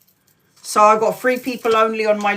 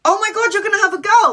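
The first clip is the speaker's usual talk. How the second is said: much higher, fast excited squeal; thrilled; 11 semitones higher, 4 dB louder, much faster than usual. Retold or imitated. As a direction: burst out with delight. Reacting to pregnancy news.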